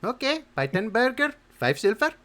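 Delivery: put-on high loud Indian accent. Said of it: "I don't know why we've gone Indian now".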